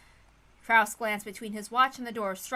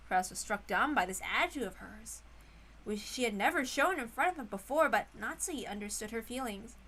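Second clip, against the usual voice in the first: plain reading voice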